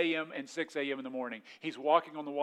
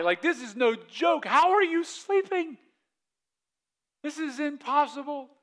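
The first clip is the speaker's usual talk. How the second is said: high, slow, drawn-out disbelief; hammed-up panic, pitched way up; panicked disciples; 9 semitones higher, 7 dB louder, slower than usual. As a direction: wail each alarm as if about to drown. Acts out disciples' panic in storm.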